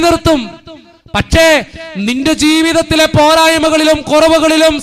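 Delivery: loud, high, impassioned sermon voice; fervent preaching. Acted. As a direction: preach it to the whole church with fire. Preaching at full force. (ഉച്ചത്തിൽ ആവേശത്തോടെയുള്ള പ്രസംഗം)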